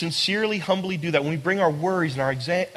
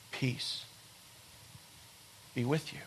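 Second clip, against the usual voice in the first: hushed blessing; 7 semitones lower, 18 dB quieter than usual. Acted. Speaking Jesus' blessing gently.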